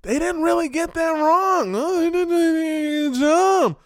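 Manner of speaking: high-pitched put-on voice, held high throughout; mock high-voiced reaction, slowing at the end; imitated local viewer. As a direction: mimic a viewer in a high, stretched voice. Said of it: "the people from the country to be like".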